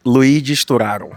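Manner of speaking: "Luigi Sturaro" said clearly and firmly, showing the name; surname pronunciation demo. Shown as a demonstration. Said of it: "como é mesmo a pronúncia do seu sobrenome".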